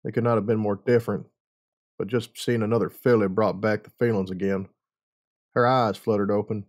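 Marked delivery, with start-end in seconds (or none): none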